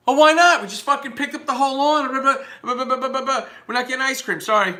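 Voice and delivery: put-on high-pitched child's protest; loud outburst sliding into high babble; whining four-year-old. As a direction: whine like a let-down little kid. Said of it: "When I was four years old".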